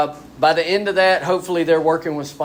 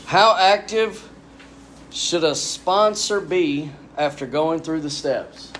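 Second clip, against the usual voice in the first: reading the question aloud; slower than usual. Real, not acted. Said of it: "gonna pull this next one".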